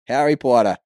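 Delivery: put-on Harry Potter in a loud Boston accent; Harry Potter as "Hahry Pottah", Boston-style. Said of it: "hear it in a Boston accent".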